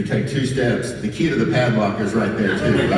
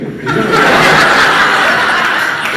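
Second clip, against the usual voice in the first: big laugh; 9 dB louder than usual. Real, not acted. Audience roaring at prank story.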